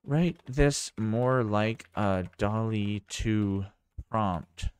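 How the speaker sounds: words spaced out as if typing; slow dictation. Real, not acted. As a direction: dictate slowly, word by word. Dictating a prompt while typing.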